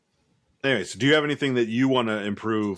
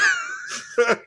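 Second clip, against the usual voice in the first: high laugh. Real, not acted. Laugh after his own retort.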